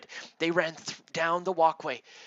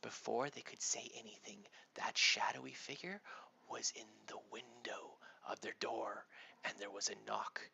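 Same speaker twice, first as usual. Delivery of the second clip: hushed, breathy whisper as danger nears; creepy storytelling whisper; suspense whisper; 9 dB quieter than usual. A narrator's reading. Suspense before the knock.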